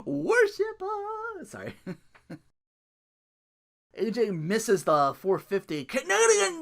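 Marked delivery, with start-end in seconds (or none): 0.0-1.5 very high, very slow put-on voice; mock villain decree. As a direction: drag it out like a cartoon villain. Voicing tag team promo.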